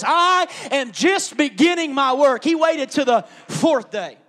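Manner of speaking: loud, driving sermon delivery; full-force preaching. Real, not acted.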